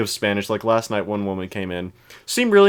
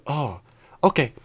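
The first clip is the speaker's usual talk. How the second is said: put-on a touch of a Spanish accent; 4 semitones higher than usual. Quoting the Spanish-speaking customer paying, lightly in a Spanish accent. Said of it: "don't know why I feel the need to do the accent".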